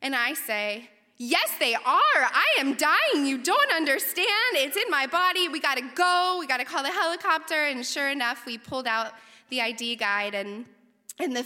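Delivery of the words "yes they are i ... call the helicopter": loud, high, hammed-up hysterical insistence; freaking out. Researcher insists to advisor she is dying, hysterical mock panic. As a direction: wail it insistently, stretching the words, then rush the end. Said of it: "and I'm freaking out".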